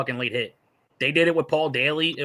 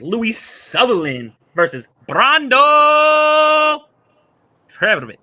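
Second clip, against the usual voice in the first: mock fight announcer; 10 semitones higher, 9 dB louder, much slower than usual.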